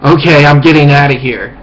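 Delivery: loud mock-alarmed exit line; retold alarm. Speaker retelling his retreat from the woman, loud mock alarm. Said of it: "And I'm like".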